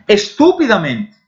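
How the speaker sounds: Estúpidamente with stress on TÚ; Spanish pronunciation. Shown as a demonstration. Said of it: "Pense nessas palavras". (Estúpidamente em espanhol, tônica no TÚ)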